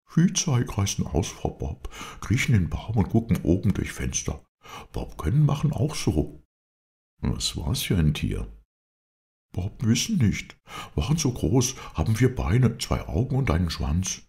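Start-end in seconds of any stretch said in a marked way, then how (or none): 0.1-6.4 high, lively character voice; Bob, eager helper. A narrator's reading. Bob tells his master how he found the tree, high and lively. 9.5-14.2 high, lively character voice; Bob, eager helper. A narrator's reading. Bob tells his master how he found the tree, high and lively.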